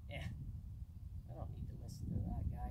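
slow, high put-on voice of a stubborn dog; dismissive dog. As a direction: shrug off the owner as a lazy dog would. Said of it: "the dog's gonna start to say".

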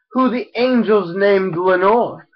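ordinary delivery, nothing marked

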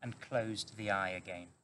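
usual speaking voice

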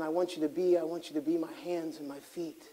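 a hint of God calling quietly; God's gentle call. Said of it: "And God's saying".

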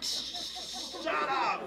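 high breathy laughing shush; laughing hush. Retold or imitated. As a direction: hush the cousin through laughter. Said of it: "I was like".

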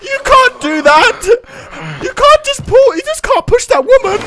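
high-pitched indignant protest; high, stretched-out disbelieving protest; outraged disbelief. Real, not acted. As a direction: squeal your outrage at the bully. Outraged at the game husband.

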